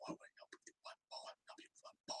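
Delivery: breathy hushed whisper. Real, not acted. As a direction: whisper it under your breath. Whispered repeats.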